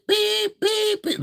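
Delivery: high bleeping tones; censor bleep. Shown as a demonstration. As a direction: imitate the TV bleep. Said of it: "do a lot of beep".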